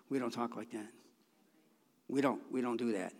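softly acted parent, pitch dropped; quiet parental correction. Parent to kids in our families, quiet correction.

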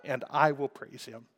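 usual speaking voice

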